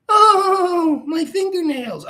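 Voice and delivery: mock flighty squeal, very high; fluttery bimbo parody, loud high "Oh"; Dylan Mulvaney. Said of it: "This flighty, bimbo-y, fluttery".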